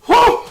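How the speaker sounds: high, loud puff of relief; breathless whew. Real, not acted. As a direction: blow out a winded whew. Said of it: "I'm out of breath".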